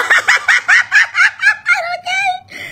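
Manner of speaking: long high laugh; real helpless laughter; long cackle. Real, not acted. Laughing at viewer reactions.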